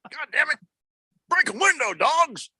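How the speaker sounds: very high, strained mock drill sergeant; army sergeant voice. Cecil ordering the dogs out, high drill sergeant voice. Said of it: "sergeant in the army kind of voice".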